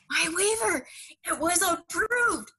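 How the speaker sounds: shaking him awake with the news; thrilled cry to a sleeping husband; excited announcement. Retold or imitated. Repeating news to groggy husband.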